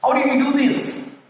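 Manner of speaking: high questioning pitch; asking a question. Shown as a demonstration. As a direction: ask it like a real question. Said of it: "you should ask, like, a question".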